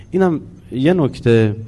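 normal talking voice

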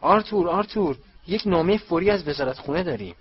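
high, loud urgent call; urgent shout. A narrator's reading. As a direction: call out urgently for Arthur through the house. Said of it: "فریاد زد". (فریادِ بلند و فوری)

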